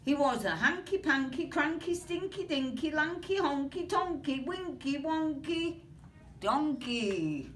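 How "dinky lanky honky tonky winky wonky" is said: ordinary delivery, nothing marked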